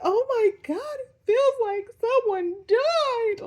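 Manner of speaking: high, slow, tearful wail; crying. Retold or imitated. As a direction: wail it out like fresh grief. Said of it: "like, I'm crying".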